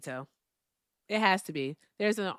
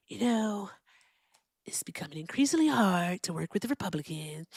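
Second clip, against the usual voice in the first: softer, breathy, half-whispered Trump voice; Donald Trump impression; 4 dB quieter than usual. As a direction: mimic Trump's whiny complaining. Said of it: "talk in the Donald Trump voice".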